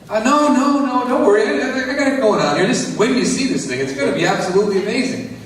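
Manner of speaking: high, over-eager Tommy brushing off doubt; defensive Tommy. Retold or imitated. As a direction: wave off the worry nervously. Tommy voiced answering neighbor.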